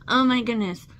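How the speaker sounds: usual speaking voice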